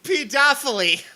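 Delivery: pedophily as "pe-DOFF-uh-lee", high and loud; mocked mispronunciation. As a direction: exclaim pedophily stressed on DOFF. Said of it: "never heard pedophile pronounced pedophily".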